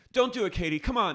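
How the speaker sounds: high-pitched mock plea; mock pleading. Friend begging Katie not to do it, high mock plea.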